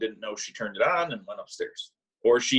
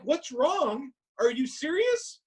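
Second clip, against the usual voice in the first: high disbelieving retort; slower than usual. Retold or imitated.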